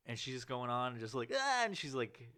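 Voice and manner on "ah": high strained ah. Retold or imitated. Comic's pained sound.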